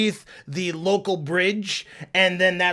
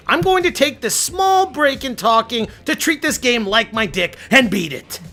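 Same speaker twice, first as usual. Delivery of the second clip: ordinary talk, nothing marked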